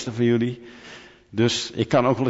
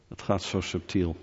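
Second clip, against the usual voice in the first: softer, lower, almost to yourself; soft repeat; 4 semitones lower, 7 dB quieter than usual. Acted. Preacher warning the congregation about subtle idols, softly. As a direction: repeat it quietly so it lands.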